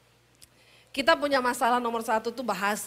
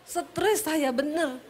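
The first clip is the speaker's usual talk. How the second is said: ordinary talk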